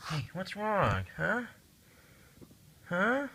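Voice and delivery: high, soft coaxing voice to a cat; high cooing at the pet; pet talk. Real, not acted. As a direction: coax the cat gently, way up high. Talking to worried-about cat.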